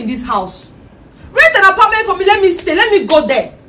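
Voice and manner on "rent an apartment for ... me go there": high, loud furious demand; angry demand. Acted. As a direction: yell at him to rent her a flat. Wife yells her demand.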